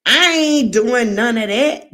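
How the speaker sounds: firm no-nonsense refusal, lightly voiced; mock sassy brush-off. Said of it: "I always used to say".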